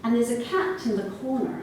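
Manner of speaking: usual speaking voice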